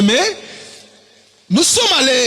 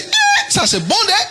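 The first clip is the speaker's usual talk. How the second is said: high-pitched mock admiration; impressed exclamation; 11 semitones higher than usual. Judah watching the prophets' tricks, high-pitched impressed delight. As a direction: leap up high on the "eh" as an eager onlooker.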